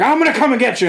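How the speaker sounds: imitated charge, pitched well up; mock battle cry. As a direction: call out a high-pitched playful threat. Retold Angron charging after Russ.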